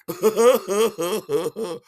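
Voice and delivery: high, loud ha-ha-ha; hooting, hammed-up laughter; drunken guffaw.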